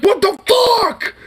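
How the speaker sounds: high, slow, stretched-out yell; a drawn-out outraged shout; imitating a yell. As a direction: belt it out like a shocked friend. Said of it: "Yelling at him".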